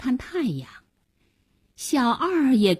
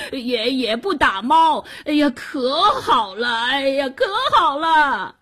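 desperate hoarse pleading; 5 semitones higher than usual. Acted. Drowning man swears he is good, desperate straining wail. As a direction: plead at full strain, spluttering. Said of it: "那个人声嘶力竭地表白着".

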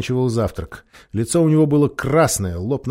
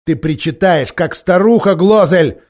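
loud, higher mocking rebuke; contemptuous scolding; 7 semitones higher, 9 dB louder than usual. A narrator's reading. King scorning Glozelle as an old woman, loud scolding. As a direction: sneer at the whining lord. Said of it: "сказал король".

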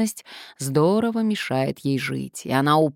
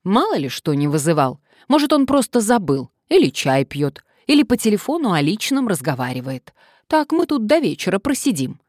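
impatient complaining woman; 5 semitones higher, 5 dB louder than usual. A narrator's reading.

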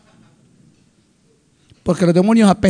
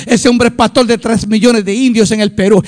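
normal talking voice

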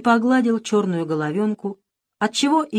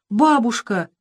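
startled cry; 4 semitones higher, 4 dB louder, slower than usual. A narrator's reading. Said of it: "вскрикнул он".

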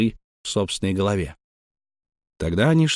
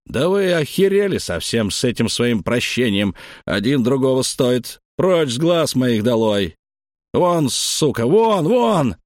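rising to a screaming rage; furious shout; 5 semitones higher, 4 dB louder than usual. A narrator's reading. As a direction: drive them out, yelling louder. Якуб гонит отца и слугу. (яростный крик, переходящий в вопль)

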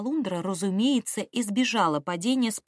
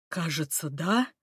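unsure; 5 semitones lower than usual. A narrator's reading. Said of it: "неуверенно ответил первый".

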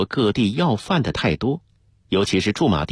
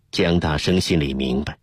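ordinary delivery

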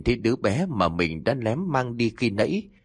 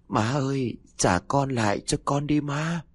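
high, pleading ghost mother; ghostly plea; 6 semitones higher than usual. A narrator's reading. Dead mother begging her mother-in-law for her child, high and pleading. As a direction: beg for the child back like a ghost.

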